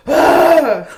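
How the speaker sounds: loud groan of disgust; annoyed loud ugh. Retold or imitated. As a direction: let out a sharp grossed-out ugh. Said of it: "I'm always like".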